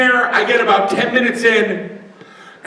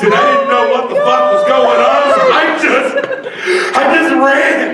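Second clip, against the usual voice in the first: panicked shouting; 6 semitones higher than usual. Pretend. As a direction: yell it out breathless. Mock panicked retelling, loud.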